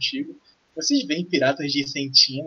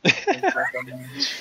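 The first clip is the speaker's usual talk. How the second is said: laughing; 12 semitones higher, much slower than usual. Acted. NPC laughs mid-word.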